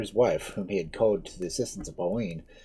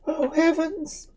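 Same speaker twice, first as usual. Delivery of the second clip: frightened cry; slower than usual. Acted. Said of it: "cried Pauline, turning pale".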